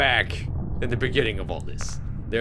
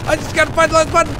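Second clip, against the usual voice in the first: rushed, even louder panic; scared horror game streamer; 8 dB louder, much faster than usual. Real, not acted. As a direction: gabble it out in fast panic. Chased, repeats line faster.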